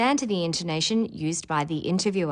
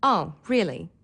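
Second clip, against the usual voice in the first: bored. Shown as a demonstration. Teacher showing learners how pitch conveys meaning, bored. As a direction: sound thoroughly uninterested. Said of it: "or boredom".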